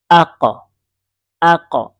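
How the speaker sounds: "আক" with the final q pushed into an echoing release; qalqalah bounce. Shown as a demonstration. Teacher demonstrating qalqalah, "আক" with a bounced final q. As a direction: say "আক" firmly, bouncing off the closing q. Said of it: "এই যে আমি একটু ধাক্কা দিলাম".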